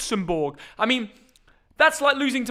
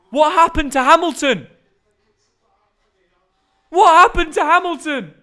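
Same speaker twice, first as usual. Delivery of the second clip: loud, higher shocked cry; 7 semitones higher, 7 dB louder than usual. Real, not acted. Streamer shocked at Hamilton's race on the Grand Prix, loud. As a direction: disbelief at the screen.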